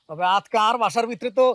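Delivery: normal talking voice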